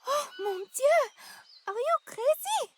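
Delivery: high, astonished gasp of a reaction; shocked disbelief. Acted. Woman just proposed to by her partner, astonished. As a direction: burst out in stunned, high-pitched disbelief.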